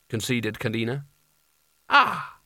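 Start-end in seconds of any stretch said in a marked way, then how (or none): 1.9-2.4 high, short frightened cry; alarmed yelp. A narrator's reading. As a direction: let out a high little cry. Frightened rider reacts to height.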